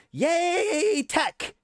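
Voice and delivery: high, drawn-out mock yay; sarcastic cheer. Real, not acted. Sarcastic cheer closing a rant.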